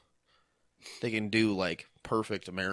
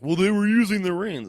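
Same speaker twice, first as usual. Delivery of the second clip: louder, high-pitched mock protest; 9 semitones higher, 9 dB louder than usual. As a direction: imitate people objecting, loud and high. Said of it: "they were like".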